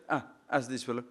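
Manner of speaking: ordinary talk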